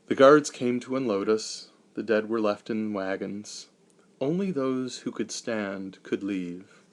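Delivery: usual speaking voice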